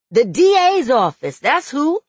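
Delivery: loud, high angry answer; heated comeback, voice climbing; upset retort. A narrator's reading. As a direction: throw the answer back sharply. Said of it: "never seen him so upset before".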